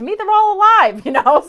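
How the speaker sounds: laughing. Real, not acted. Psychic laughing to viewer about angels looking alive. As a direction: let a real giggle break through the words.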